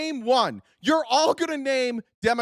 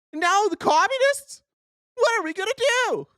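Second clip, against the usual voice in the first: high, hammed-up panic; MAGA voice; 11 semitones higher than usual. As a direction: wail in mock alarm as a scared partisan. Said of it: "that's my maga voice".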